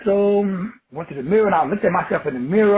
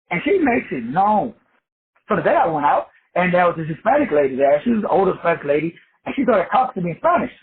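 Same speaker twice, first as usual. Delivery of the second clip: normal talking voice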